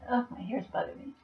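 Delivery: ordinary delivery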